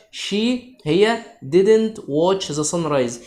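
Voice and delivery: very slow, words spaced out; slow dictation. Shown as a demonstration. Teacher dictating an example to pupils, very slow. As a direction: read the example slowly to the class.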